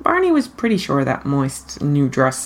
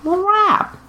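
high, slick Hollywood lunch pitch; breezy showbiz drawl; showbiz schmoozer. Acted. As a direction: sell it like an agent. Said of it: "Let's do lunch".

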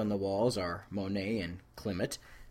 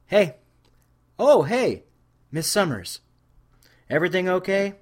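loud, high, eager greeting; young guard calling out to Buffy, loud and high; 9 semitones higher, 11 dB louder than usual. Acted. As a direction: hail her brightly across the room.

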